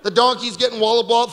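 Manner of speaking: normal talking voice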